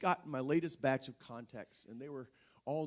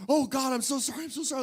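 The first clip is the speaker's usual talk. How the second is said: frantic apology; 11 semitones higher, 10 dB louder, faster than usual. Retold or imitated. Frantic repenting to God.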